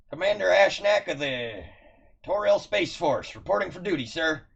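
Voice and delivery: loud, high stiff military bark; stiff barking toy soldier. Acted. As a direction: snap to attention and bark it. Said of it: "and he's like".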